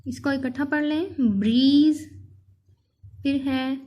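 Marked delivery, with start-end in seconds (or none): none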